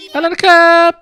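put-on high, loud little-boy voice; boy's mealtime line. Boy to family before the meal, high loud boy voice. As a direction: pipe up loudly as a boy before eating.

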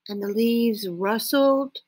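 usual speaking voice

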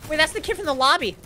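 excited yell. Real, not acted. Recognizing enemy, loud yell.

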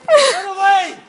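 screaming get away at them; frantic furious screaming. Real, not acted. Man shouting at people removing him.

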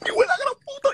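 a sudden high yell of disbelief; shocked shout. Real, not acted. Hearing the confession on the live, a shocked high shout. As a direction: blurt it out at the top of the voice. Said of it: "Yo no puedo gritar aquí".

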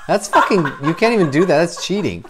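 high, loud comic protest with a laugh; laughing indignation. Acted. Comic disputes his bad review score, laughing indignation. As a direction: object loudly, half laughing.

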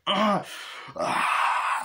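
high cry trailing into breath; mock cry of agony; imitated pain cry. Reviewer acting out the man's transformation, pained cry.

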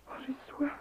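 a faint plea for water; weak whisper. Acted. Dying wife whispers to her husband that she is thirsty. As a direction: whisper it, no voice left.

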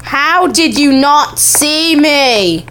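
slow, drawn-out mock British accent; put-on British-ish accent. Player baffled at not being seen, put-on British-ish accent. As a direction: stretch it out in a hammy, uncertain British accent. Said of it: "why do I have this accent".

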